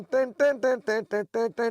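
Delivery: usual speaking voice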